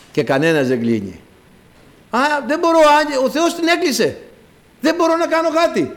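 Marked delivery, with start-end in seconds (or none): 2.1-5.9 much higher voice for Noah's reply; helpless refusal. Retold or imitated. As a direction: protest high-pitched that nothing can be done. Noah answers those locked out.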